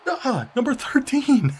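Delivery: high, loud squeak of disbelief at the player; disbelieving squeal. Real, not acted. Gamer shrieking at an opposing player's throw, stunned. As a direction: yelp the number in shock.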